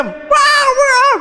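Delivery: put-on very high, loud children's yell; mock shrieking children; screaming kids.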